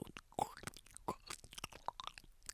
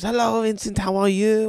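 fake cheery greeting; 8 semitones higher, 5 dB louder than usual. Therapist voiced after crying.

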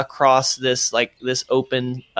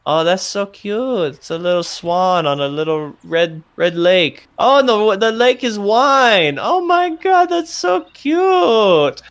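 starts cooing, then squeals higher and louder to a shout; gushing over cuteness; 6 semitones higher, 3 dB louder than usual. Acted. Tower guard cooing at the paper boat in the wine, building to a squeal. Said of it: "you hear him go".

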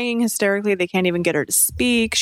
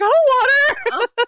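high mock panic; 4 dB louder, slower than usual. Retelling wife refusing creepy child.

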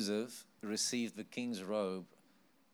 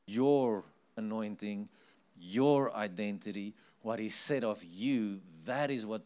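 preaching; 6 dB louder than usual. Acted. Preaching emphasis.

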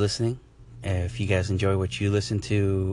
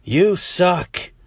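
slow, higher caller voice building to a jeer; heckling listener voice; 5 semitones higher, slower than usual. Pretend. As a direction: play a rude caller. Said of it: "You could be like".

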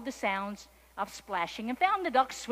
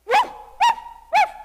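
high, loud dog barks; startled dog woofs; 17 dB louder, slower than usual. A narrator's reading. Startled dog answers the kitten with high, loud woofs. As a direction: yelp out sharp, high woofs. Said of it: "said the startled dog".

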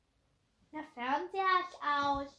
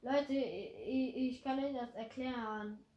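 ordinary talk

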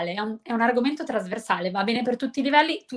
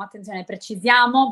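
ordinary talk

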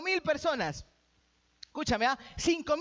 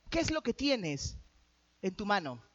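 calm, slow question; 6 semitones lower, 4 dB quieter than usual. Acted. Jesús pregunta con calma.

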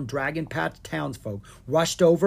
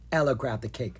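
plain reading voice

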